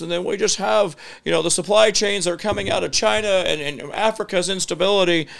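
high-pitched rambling politician imitation; Bill Clinton. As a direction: dodge the question in a high put-on voice. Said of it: "this is the worst Bill Clinton imitation".

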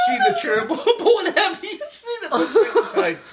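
high, laughing through the words; gasping with laughter at the prank; helpless laughter. Real, not acted. Dad laughs high and hard recalling the girls watching the chair.

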